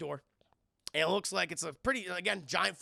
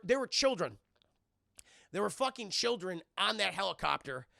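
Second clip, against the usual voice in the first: usual speaking voice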